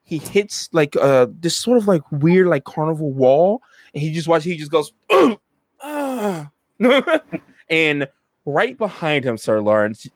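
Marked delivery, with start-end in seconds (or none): 5.1-6.5 wounded ugh then high yelp; pained groan. Acted. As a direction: groan like you just hit a wall. Character groans as he hits wall.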